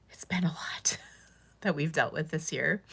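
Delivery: whispered through tears with a broken laugh; barely voiced, crying; real crying whisper about the hard year; tearful whisper. Real, not acted. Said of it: "I'm gonna stop crying now".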